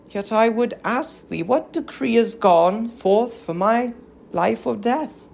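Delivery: calmly face the husband who hates her; gentle and unafraid. Acted. Helen asking King Menelaus her fate, gentle and unafraid. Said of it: "gentle and unafraid".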